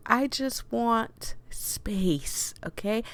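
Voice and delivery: slow whisper; whispered plea. Pretend. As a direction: beg softly for space. Player pleading with captor.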